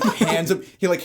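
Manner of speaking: ordinary delivery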